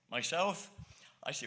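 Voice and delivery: usual speaking voice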